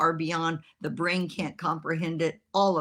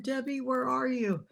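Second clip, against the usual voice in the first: mock plaintive call; 7 semitones higher than usual. Assistant grabbed by odd lady, mock plaintive call. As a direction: call out helplessly for a rescuer. Assistant's plea for help, voiced.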